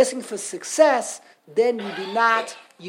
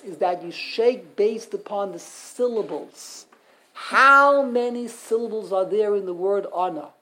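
ordinary talk, nothing marked

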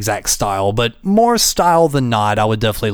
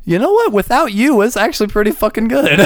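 high mock quote; 10 semitones higher than usual. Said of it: "I went".

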